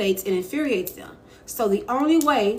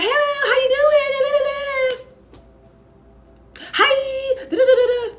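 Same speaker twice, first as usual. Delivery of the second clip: squeaky, much higher, louder fake-friendly hello; overeager high chirpy greeting; 5 dB louder, much faster than usual. Shown as a demonstration. To coworkers, showing the eager voice to avoid, squeaky overeager chirp. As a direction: gush like an overeager people-pleaser. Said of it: "Don't be so eager".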